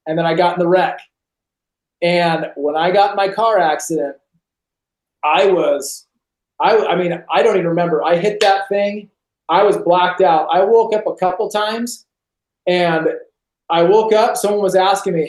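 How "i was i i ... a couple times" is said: usual speaking voice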